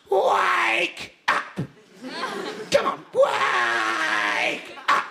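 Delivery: high, drawn-out yell; hammed-up high shout to a sleeper; shouted wake-up call. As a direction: stretch it out like a merciless human alarm. Captain waking hungover man on pillow.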